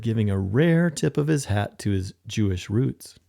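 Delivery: ordinary delivery, nothing marked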